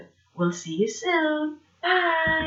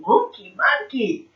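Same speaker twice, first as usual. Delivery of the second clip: ordinary talk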